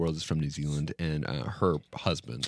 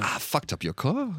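high-pitched New Zealand impression; teasing, pitched-up New Zealand voice; mock New Zealand accent; 8 semitones higher than usual. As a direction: confess sheepishly in a fake New Zealand accent. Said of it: "make fun of his accent".

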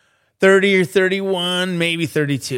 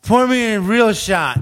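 slow, high, loud mock-drunk order; drunk demand; 7 semitones higher, 5 dB louder than usual. Shit-faced customer ordering the bartender around, loud drawn-out demand. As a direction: play a drunk demanding a stronger pour. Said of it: "I proceed to get shit-faced".

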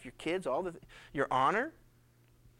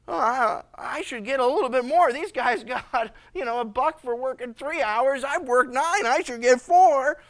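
a touch louder, pushy and aggrieved; mildly hammed-up grievance; indignant demand; 4 semitones higher, 6 dB louder than usual. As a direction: argue you deserve more. Nine-hour worker demands more.

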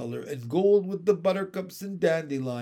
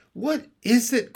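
high, louder, eager question; eager cry; 8 semitones higher, 5 dB louder than usual. A narrator's reading. Jane to her brother at the window, eager cry. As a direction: cry out, desperate to know. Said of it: "cried Jane".

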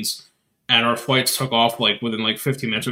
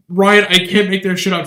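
friend's voice raised higher and louder; mock exasperated quote; 7 semitones higher, 6 dB louder than usual. Friend retelling airport fiasco, raised disbelieving voice. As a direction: act out her disbelief, pitched up. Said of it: "she goes".

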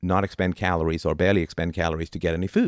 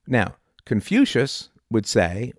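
ordinary delivery

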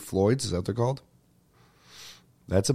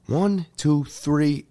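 one, two, pause, three, with the count evenly spaced; counting it properly; 5 semitones higher, much slower than usual. Shown as a demonstration. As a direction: count steadily to three before throwing it back. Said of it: "It means we go".

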